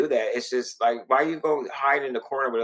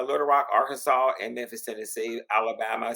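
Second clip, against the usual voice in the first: ordinary talk, nothing marked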